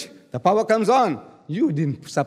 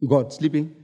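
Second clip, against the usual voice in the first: usual speaking voice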